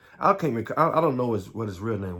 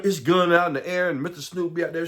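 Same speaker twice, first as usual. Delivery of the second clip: normal talking voice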